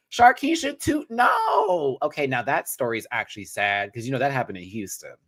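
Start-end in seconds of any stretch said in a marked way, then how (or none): 0.1-2.0 high-pitched shouted plea; mock shout. Streamer parodying a viral clip with a pun, high mock shout. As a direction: cry it out, stretching the last word.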